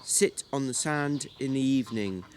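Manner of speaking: usual speaking voice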